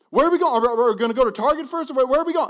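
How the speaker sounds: high, loud anxious pestering; a kid pestering Dad from the back seat; whiny child in car. Pretend. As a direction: nag like a kid on a road trip. Abram pestering again.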